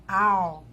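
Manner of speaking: "au" as a held A gliding into U; L sounded as U. Shown as a demonstration. Teacher demonstrating A with L at the end, L sounded as U. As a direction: draw out "au", ending on a U sound. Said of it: "um som de U".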